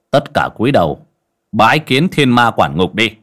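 a solemn call to bow before a demon lord; stern command. Acted. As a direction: order the household to bow now. Shaman commands family to bow.

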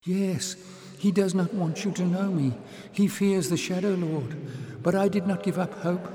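put-on high, eager young voice; excited girl. Little sister excitedly telling Jasmine of the victory. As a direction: rush it out excitedly.

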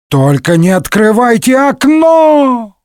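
high, slow panicked cry; panicked shout. A narrator's reading. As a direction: yell it in alarm. Panic in the submarine.

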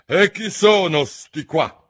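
high, loud and slow, demanding; imperious queen. Acted. La regina chiede dei giardinieri, imperiosa. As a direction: bark the question at the court. Queen asks about gardeners.

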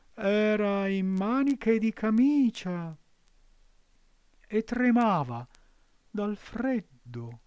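high, slow fox voice, sweetly cunning; the fox coaxing the puppet; sly fox. A narrator's reading. Fox answers Pinocchio.

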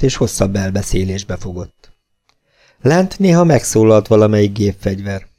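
ordinary delivery, nothing marked

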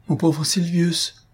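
high, slow, acted grief; drawn-out sorrowful address, understated sob; sobbing. A narrator's reading. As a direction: lament with a touch of tears. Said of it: "répondit Albina en sanglotant".

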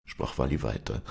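plain reading voice

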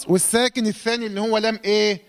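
ordinary talk

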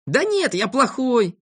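put-on high, drawn-out boyish protest; slow, high-pitched pouting voice; self-blaming sulk. A narrator's reading. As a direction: insist miserably, stretching the words. Sulking boy refuses comfort.

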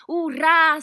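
very loud, high cheer; joyful shout. A narrator's reading. Cat told it may go fishing, cheering loudly. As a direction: let out a big hurrah. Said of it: "закричал кот".

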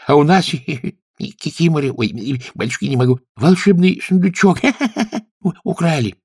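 laughing through the words; giggling, gasping between words; being tickled. Acted. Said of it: "смеясь от щекотки". (сквозь смех от щекотки)

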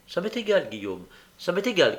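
plain reading voice

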